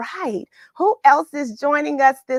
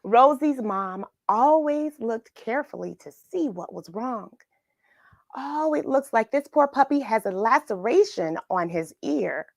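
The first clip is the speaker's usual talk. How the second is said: story reading; 2 semitones lower than usual. Acted. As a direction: read it like storytime, unhurried. Reads picture book aloud.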